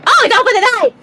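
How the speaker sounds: real gamer astonishment; excited shock. Real, not acted. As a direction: yelp at the sudden surprise. Streamer reacts to game scene.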